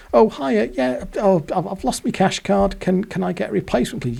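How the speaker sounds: high, bright mock girlfriend voice asking politely; girlfriend at the bank. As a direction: put on a high sweet voice for the girlfriend.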